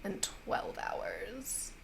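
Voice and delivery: soft, slowing, savoured count; fey lady. Acted. Fey lady congratulating the players on her joke, softly savoured.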